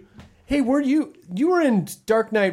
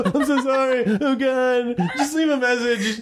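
mock teary voicemail; 8 semitones higher than usual. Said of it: "That would".

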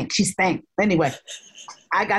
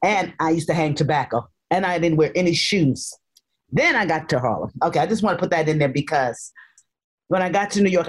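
normal talking voice

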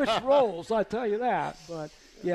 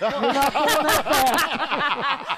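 slow, louder, breaking into laughter; laughing; 5 semitones higher, 7 dB louder, much slower than usual. Real, not acted. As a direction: laugh through the punchline. Punchline of wife's teasing.

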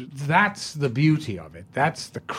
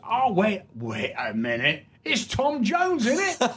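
hammed-up Cockney pub heckler, pitched way up; Cockney fan; 9 semitones higher than usual. Cockney stranger barging into the pub snug, high hammed-up Cockney. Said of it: "And then he goes".

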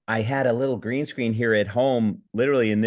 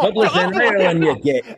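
high, loud Scottish correction; indignant Scottish accent; 9 semitones higher, 6 dB louder than usual. Acted. Scottish character corrects the host about Dublin. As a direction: set him straight in a Scottish brogue. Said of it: "a Scottish Brian".

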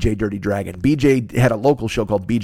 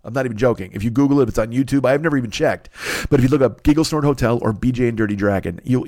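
ordinary talk

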